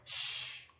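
soft unvoiced shush; a quick quiet shh; hushing shh. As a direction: hush them like a librarian. Silencing a bad teacher.

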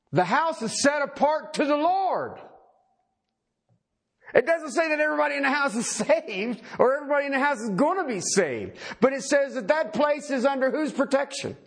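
high-pitched, insistent preacher's delivery; emphatic preaching. Real, not acted. Preacher explaining the house set apart to the Lord, insistent high preaching. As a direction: drive the point home high and urgent.

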